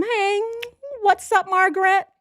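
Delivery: hammed-up high girlfriend voice; mock asylum inmate greeting. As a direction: gush hello at Margaret like a giddy patient. Imagined asylum inmate greeting friend.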